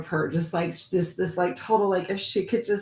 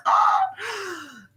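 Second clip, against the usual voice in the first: mock cry; 13 semitones higher than usual.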